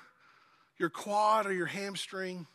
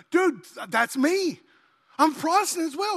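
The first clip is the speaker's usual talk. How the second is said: thrilled discovery; 8 semitones higher, 8 dB louder than usual. Acted. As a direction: exclaim it high and loud, delighted. Acted joke, thrilled at match.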